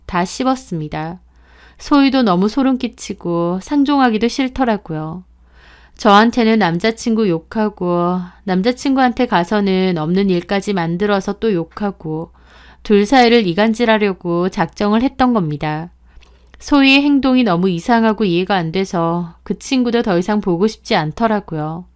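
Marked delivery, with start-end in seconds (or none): none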